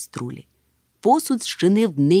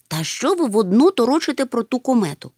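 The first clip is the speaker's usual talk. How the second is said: mildly angry, raised pitch; annoyed; 6 semitones higher than usual. Acted. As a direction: snap lightly in exasperation. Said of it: "розсердився Мумітроль". (роздратовано, трохи сердито)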